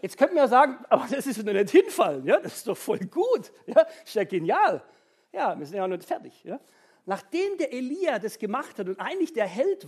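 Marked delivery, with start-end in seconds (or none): 0.2-4.8 louder, laughing mock objection; laughing mock protest. Preacher jokingly voices the congregation, laughing and loud. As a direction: toss out the objection through laughter.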